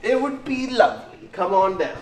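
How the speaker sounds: put-on British accent, inviting tone; mock British accent. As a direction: keep the playful British accent going. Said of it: "what am I doing that accent for?".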